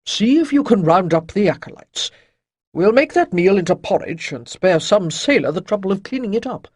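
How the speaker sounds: put-on loud, brisk old sage; kindly elder giving tasks; old mentor voice. A narrator's reading. Chayden gives orders.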